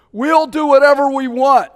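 loud and high, careless defiance; defiant boast. Retold or imitated. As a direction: toss it off like a rebel. Quoted defiance.

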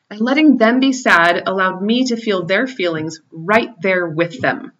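ordinary delivery

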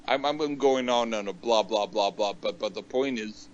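normal talking voice

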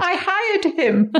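laughing through the words. Real, not acted. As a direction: giggle out the answer. Laughing at her own answer.

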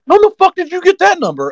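very high astonished mock exclaim; shocked outburst. Crew guy learning the car number, very high stunned exclaim. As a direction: throw it up high in disbelief.

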